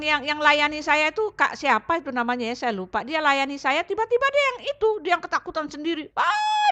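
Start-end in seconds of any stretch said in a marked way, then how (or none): 6.2-6.7 very high drawn-out "aah"; imitated scream. Helper seeing a big dog under the bed, imitated scream. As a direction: mimic a frightened scream without full volume. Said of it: "dia teriak-teriak gitu".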